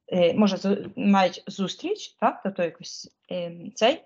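ordinary delivery

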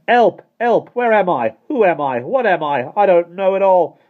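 high, loud cries for help; panicked shouting. A narrator's reading. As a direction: shout in mounting bewilderment, lost. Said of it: "he shouted".

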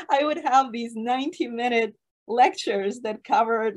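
ordinary talk, nothing marked

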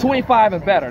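pumped-up announcement; excited cheer. Acted. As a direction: cheer the number on. Gas pump reaching twenty-five.

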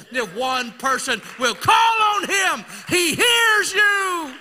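rising shout, stretched slow on the last words; preaching shout. Real, not acted. Preacher shouting to the congregation that God hears. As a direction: proclaim it with full force.

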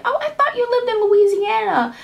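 high-pitched mimicking of surprised clients; imitated clients. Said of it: "clients hit me up and be like".

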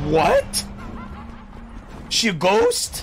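sharp startled what; surprised. Real, not acted. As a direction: caught off guard, pitch jumps up. Sudden boss surprise.

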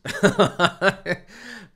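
high, loud drawn-out laughter; real long laugh. Real, not acted. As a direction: laugh it off loudly. Laughs after telling the story.

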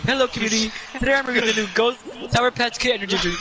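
high, fast reviewer impression; TikTok reviewer voice. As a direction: mimic a cheesy TikTok reviewer. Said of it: "He's like".

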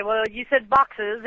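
normal talking voice

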